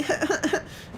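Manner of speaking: high, airy put-on tittering; mock girly giggle. Girl reacting to the British guy, high mock giggle. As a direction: imitate a girl's giggle in falsetto. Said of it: "She's like".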